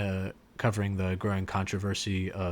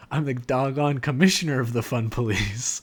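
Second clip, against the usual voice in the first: louder, higher mock outburst with a laugh; 4 semitones higher, 6 dB louder than usual. Dad outbursting at his son, loud and laughing. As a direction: blurt it out like an indignant dad, laughing. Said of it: "Jeff just outbursts".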